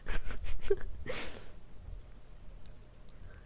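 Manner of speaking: breathy, voiceless giggle; real giggle trailing off; laughing. Real, not acted. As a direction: laugh off your own mistake. Laughs after excusing her English.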